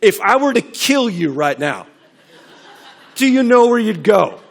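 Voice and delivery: loud, high, confrontational fire-and-brimstone question with a laugh; hammed-up scare-tactic evangelist; pushy old-style evangelist. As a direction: corner them with hell like a street preacher. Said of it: "This is what we did, and we called that evangelism".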